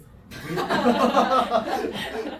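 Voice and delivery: high, loud burst of laughter. Real, not acted. Teacher admits his confidence was misplaced, loud laughing. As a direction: laugh out loud at your own slip.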